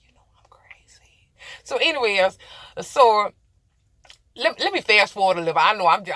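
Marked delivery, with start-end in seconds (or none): 0.1-1.3 sheepish apology sinking to a whisper; embarrassed, fading into a hushed whisper. Real, not acted. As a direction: trail off softer and softer into a whisper. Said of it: "I'm just blabbering on".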